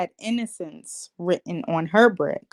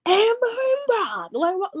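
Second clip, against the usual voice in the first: hollering; 13 semitones higher than usual. Retold or imitated. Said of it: "stop all that hollering".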